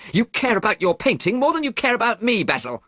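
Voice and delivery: high, loud hurt accusation; shouted. A narrator's reading. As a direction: yell it at him, high and loud. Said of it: "he shouted".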